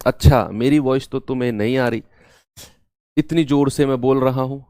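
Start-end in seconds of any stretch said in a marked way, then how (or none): none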